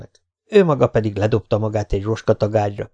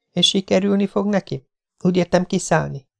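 higher, lighter voice for the heroine, curious; young woman's voice; 9 semitones higher than usual. A narrator's reading. Jessica asks Spider about his friend, higher curious voice. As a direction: play the young woman, a little doubtful.

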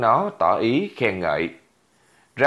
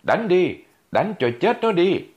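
high-pitched yelling crowd egging him on; pitched way up, cheering on the fight; 9 semitones higher than usual. Acted. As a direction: shout it up high like a baying crowd. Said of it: "Rất nhiều tiếng gào khuyến khích".

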